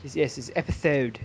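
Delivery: "episode" lisped as "epithode", s turned to th; fake lisp. Shown as a demonstration. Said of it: "I'm gonna say all S's like this".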